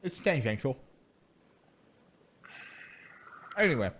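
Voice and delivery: talking through a mouthful of pizza; chewing while speaking, mouth full. Real, not acted. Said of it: "I'm talking with my mouth full".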